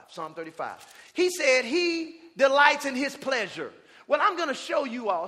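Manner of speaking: usual speaking voice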